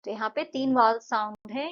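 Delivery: ordinary talk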